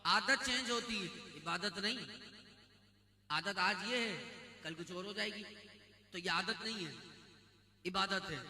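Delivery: loud, hammering sermon voice; fiery preaching. Acted. Preacher telling the crowd it is worship not habit, loudly. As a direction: drive the point home.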